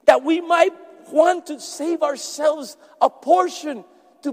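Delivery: loud, rising sermon delivery; preaching. Acted. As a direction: preach it out to the congregation. Preaches at full force.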